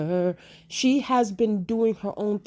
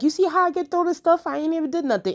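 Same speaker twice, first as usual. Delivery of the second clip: much higher, sassy reality-star meme voice; Nene Leakes meme voice; 8 semitones higher, 3 dB louder than usual. Retold or imitated. Rhaenyra denying the murder, in a high Nene Leakes meme voice. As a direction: play it like a diva shrugging off blame. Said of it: "In my Nene Leakes meme voice".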